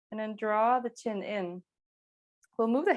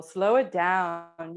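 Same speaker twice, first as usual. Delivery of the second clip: very slow, stretched-out words; 4 dB louder, much slower than usual. Real, not acted. Yoga teacher slowing the students' breathing, drawn out. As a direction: draw it out to slow their breathing.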